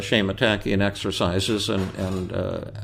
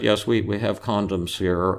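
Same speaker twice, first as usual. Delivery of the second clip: usual speaking voice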